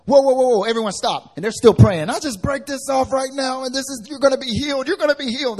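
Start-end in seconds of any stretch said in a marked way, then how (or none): 0.0-1.3 loud, urgent whoa to halt them; stopping shout. Retold or imitated. Pastor stopping an overzealous team, loud. 2.1-5.6 high, loud, hammed-up sing-song binding prayer; zealous prayer. Team member shouting prayers over the sick, hammed-up. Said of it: "they were hecka zealous".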